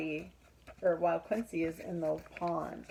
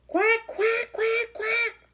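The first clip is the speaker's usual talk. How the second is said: much higher, loud quacking; shouting duck; 8 dB louder, slower than usual. Acted. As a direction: blast out the quacks like a noisy duck. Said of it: "shouts Dottie".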